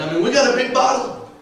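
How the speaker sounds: ordinary delivery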